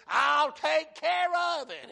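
loud, high, drawn-out promise; emphatic assurance. Acted. God promises to handle the enemy, emphatic loud assurance. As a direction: belt out the promise slowly. God's answer, voiced by the preacher.

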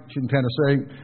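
"Tennessee" ending in a drawn "say", imitated local accent. Preacher joking about a friend's wife, imitated Tennessee accent. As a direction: mimic a Tennessee local saying "Tennessee". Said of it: "you could tell she was from Tennessee".

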